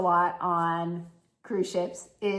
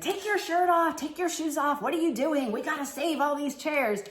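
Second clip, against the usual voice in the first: mock yelling wife; 6 semitones higher than usual. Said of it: "she starts yelling at her husband".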